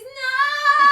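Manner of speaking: high, loud sung wail of no; mock anguished cry. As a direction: belt it like a tragic hero. Mock anguish over responsibilities.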